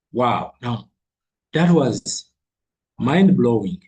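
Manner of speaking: ordinary talk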